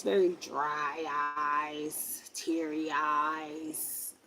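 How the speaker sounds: slow, low advert read; commercial voice. Retold or imitated. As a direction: drag it out like an eye-drop commercial. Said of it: "She reminds me of that commercial".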